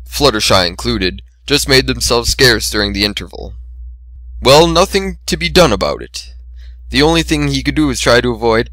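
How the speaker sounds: usual speaking voice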